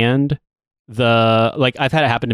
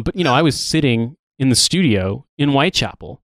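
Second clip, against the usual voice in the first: ordinary talk, nothing marked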